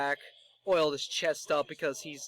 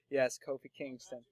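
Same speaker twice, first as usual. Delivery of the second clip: ordinary delivery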